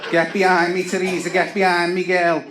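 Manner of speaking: herd your mate behind you, fiercely; fierce Scouse girl. Retold or imitated. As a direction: protective Scouse call. Girl shielding her friend.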